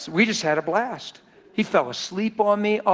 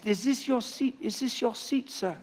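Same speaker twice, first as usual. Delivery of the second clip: broken English; 6 semitones higher, 6 dB quieter than usual. Retold or imitated. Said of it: "he said in broken English".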